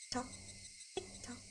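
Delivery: barely audible ticking; drugged girl murmuring the clock's sound; faint dazed murmur. Acted. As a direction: breathe the ticking out, emptied.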